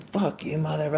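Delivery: high, hushed mock cussing; retold cussing. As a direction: imitate him cussing in a high, soft voice. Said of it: "cussing at me".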